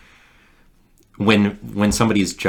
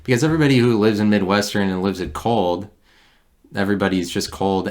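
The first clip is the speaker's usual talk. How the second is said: usual speaking voice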